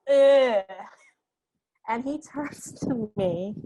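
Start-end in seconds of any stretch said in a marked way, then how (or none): 0.1-0.6 another loud retold groan of distaste. Rejecting the director's music, a loud groan. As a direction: groan like the music offends you. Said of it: "I was just like".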